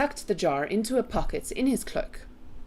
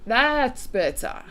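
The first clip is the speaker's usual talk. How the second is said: higher, slow, drawn-out approval; storybook giant savouring relief; relieved giant; 6 semitones higher, 4 dB louder, slower than usual. A narrator's reading. BFG relieved at soft voice.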